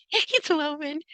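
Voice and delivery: high, gushing delight over the word woven; giddy squeal. Shown as a demonstration. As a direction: squeal "It's woven" like your heart just melted. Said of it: "makes my little heart go".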